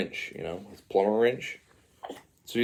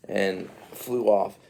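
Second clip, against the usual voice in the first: ordinary delivery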